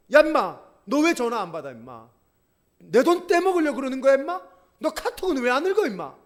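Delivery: a debtor yelling at the man who owes him; angry yell. Retold or imitated. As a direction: shake him by the collar and yell. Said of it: "멱살을 흔들면서 얘기를 했어요".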